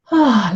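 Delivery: an open-mouthed sighing ah; relaxed sigh through an open mouth. Shown as a demonstration. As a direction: let the breath out on a loose ah. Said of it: "like when you take a sigh".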